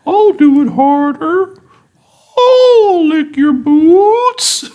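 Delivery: loud, high, very slow whale wail. Acted. Whale servant grovelling to the mistress, hammed-up whale voice. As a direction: moan each word like whale song. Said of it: "in a more whale voice".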